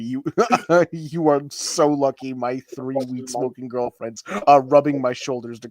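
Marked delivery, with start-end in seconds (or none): none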